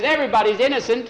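put-on high, fast, loud boyish claim; boy's urgent argument. Boy arguing law for his dog, urgent high voice. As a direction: rattle it off high and insistent.

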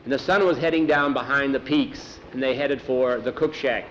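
ordinary delivery, nothing marked

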